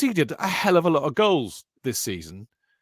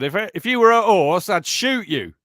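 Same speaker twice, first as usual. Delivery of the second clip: put-on a light take on a blunt old football manager, a bit higher and louder; Brian Clough impression; 6 semitones higher, 6 dB louder than usual. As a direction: quote the gaffer with a hint of his swagger. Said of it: "as Brian Clough said".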